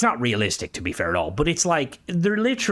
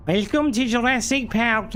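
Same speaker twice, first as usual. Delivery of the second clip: "Welcome to Jurassic Park" pitched much higher in a put-on Scouser accent; 11 semitones higher than usual. Shown as a demonstration. Streamer doing the requested line for Discord, in a Scouser accent. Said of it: "the Scouser version?".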